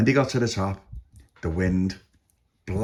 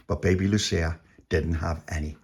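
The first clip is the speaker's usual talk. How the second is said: plain reading voice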